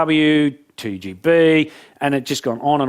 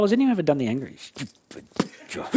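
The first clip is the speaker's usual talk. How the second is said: usual speaking voice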